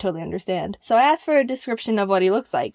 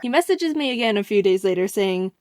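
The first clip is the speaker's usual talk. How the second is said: usual speaking voice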